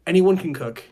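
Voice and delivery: usual speaking voice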